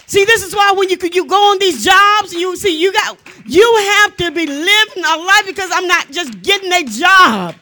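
emphatic, driving sermon voice; forceful preaching. Real, not acted. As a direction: declare it boldly to the congregation.

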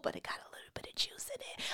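whispered gossip aside. Real, not acted.

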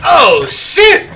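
ordinary delivery

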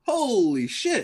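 slow, high-pitched amazement; stunned. Real, not acted. Friend hearing about the toilet paper haul, slow stunned. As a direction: stretch it out in awe. Reacting to thirty-six rolls.

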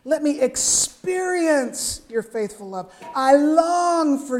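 slow ache of longing in the voice; intense longing. Shown as a demonstration. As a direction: read it like a soul aching for God. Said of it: "Listen to that intense emotion".

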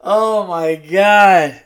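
loud, high, drawn-out delight; slow exclamation after cracking up; recovering from laughter. Real, not acted.

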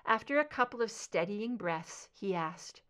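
usual speaking voice

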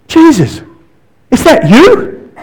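high, slow hopeful call; Peter calling out. Retold or imitated. Peter calling to Jesus on the lake, high and slow. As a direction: call across the water, stretched and high. Peter calls across the water.

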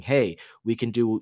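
usual speaking voice

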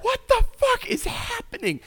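very high-pitched mock disbelief; hammed-up high squeal of confusion; acted bewilderment. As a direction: squeak it out in utter bafflement. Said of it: "And I'm just like".

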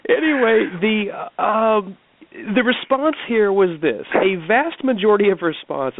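reading aloud with suppressed giggles; laughing. Real, not acted. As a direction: try to read on while cracking up. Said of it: "I'm laughing now".

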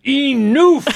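high, loud mock yell; yelled movie quote. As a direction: bellow it like the movie character does. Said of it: "as often as we yell to each other".

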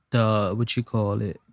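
ordinary talk

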